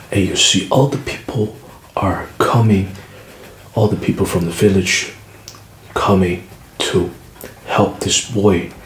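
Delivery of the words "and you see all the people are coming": ordinary delivery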